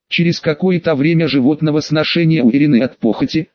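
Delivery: plain reading voice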